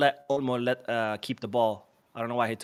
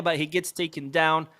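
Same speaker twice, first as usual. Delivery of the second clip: usual speaking voice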